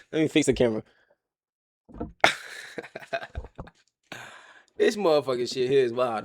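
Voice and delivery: ordinary delivery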